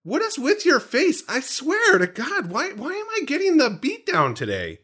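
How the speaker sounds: exaggerated offended whine; mock indignation. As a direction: play wounded at being teased. Mock outrage at co-host.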